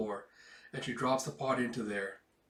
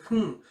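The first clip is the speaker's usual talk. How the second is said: high hum; 8 semitones higher, 8 dB louder than usual. Retold or imitated. Girl hesitates.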